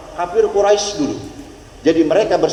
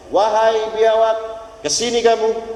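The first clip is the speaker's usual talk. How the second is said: a slow, raised call; calling the lizard; 5 semitones higher, slower than usual. Retold or imitated. The Prophet summons the lizard, a slow call. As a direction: beckon it over, slowly.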